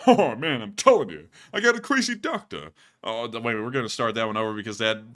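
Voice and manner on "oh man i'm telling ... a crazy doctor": Rodney with a voice crack. Acted. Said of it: "that was a voice crack in the middle of doing a Rodney".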